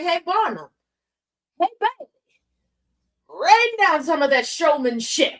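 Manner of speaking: high, coaxing call to Barnum's ghost; mock summoning. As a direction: sweet-talk a spirit through the board. Summoning Barnum via Ouija.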